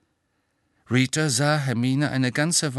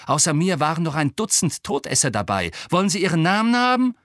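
loud, high, building indignation; heated retort, racing into the final question; angry snarl; 8 semitones higher, 6 dB louder, faster than usual. A narrator's reading. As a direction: throw it back as a rising challenge. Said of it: "fauchte Harry".

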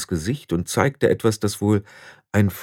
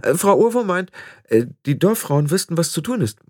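hopeful; 9 semitones higher than usual. Acted. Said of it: "sagte Anagramma hoffnungsvoll".

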